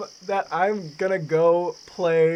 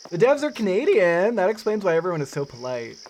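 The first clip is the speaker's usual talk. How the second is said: ordinary talk, nothing marked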